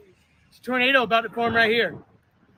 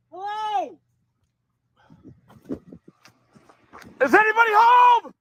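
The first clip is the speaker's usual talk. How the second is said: calling down a hallway; 11 semitones higher, slower than usual. Real, not acted. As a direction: shout to find anyone inside. Calling for people in damaged house.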